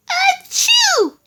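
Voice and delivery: a big, high-pitched, loud sneeze. A narrator's reading. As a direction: let out a squeaky rabbit sneeze. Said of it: "Presently Peter sneezed".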